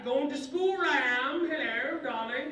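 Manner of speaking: high, slow British schoolboy; British accent. Acted. Schoolboy in vest and bow tie, British accent. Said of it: "I spoke British English".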